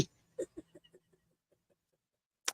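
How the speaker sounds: fast, laughing. Real, not acted. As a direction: rush it out giggling. Laughing, rushed.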